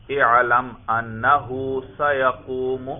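slow, deliberate اعلم انہ سیقوم; slow example. Shown as a demonstration. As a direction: lay out the original form word by word. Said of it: "تو اصل میں یوں تھا". (آہستہ آہستہ اصل صورت)

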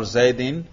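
زیدین said "Zaydin", with an i; genitive ending. Shown as a demonstration. Teacher showing the class audible case endings, زیدین as "Zaydin". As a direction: end زیدین in "-in". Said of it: "میں نے جر پڑھا".

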